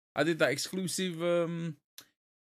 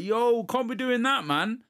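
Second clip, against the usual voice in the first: imitating a scolding uncle, higher, louder and slow; a mock telling-off, drawn out and raised; 8 semitones higher, 4 dB louder, slower than usual. Uncle scolds Sebastian for bad magic, hammed-up scolding. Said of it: "And Dad's like".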